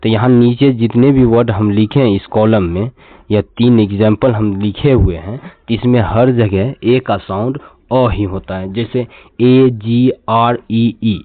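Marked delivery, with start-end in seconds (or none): none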